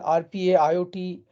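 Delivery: ordinary delivery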